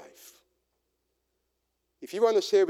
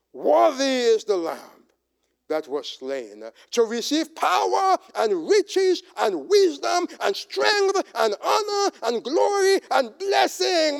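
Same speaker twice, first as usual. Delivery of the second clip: booming proclamation, building to a shout; loud praise shouted over the crowd; 7 semitones higher, 6 dB louder, slower than usual. Acted. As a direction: proclaim it to the heavens at full voice. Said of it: "saying with a loud voice".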